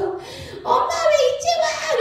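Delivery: put-on very high, drawn-out child's wail; hammed-up tearful complaint, slow and high; imitated crying child. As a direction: wail like a kid left waiting for mom. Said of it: "이러면서 막 우는 거예요".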